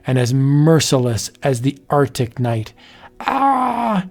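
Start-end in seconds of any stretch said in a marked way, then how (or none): 3.2-4.1 high, desperate cries of no and help; victim's terrified scream. Acted. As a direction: shriek as the claws come down. Victim attacked in the night.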